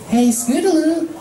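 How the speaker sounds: put-on high young pony calling out; filly hailing Scootaloo on the road. Dinky voiced character.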